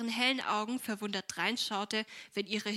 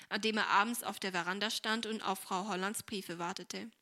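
usual speaking voice

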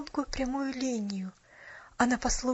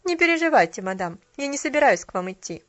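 cold disapproval; 2 semitones higher, 8 dB louder than usual. A narrator's reading. Cashier refusing the lady's invitation, cold and disapproving. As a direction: cut her off coldly. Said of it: "прервала её довольно холодно".

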